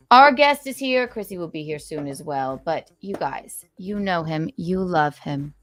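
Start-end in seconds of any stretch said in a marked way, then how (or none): 0.1-1.1 excited announcement. Acted. Repeat, announced louder.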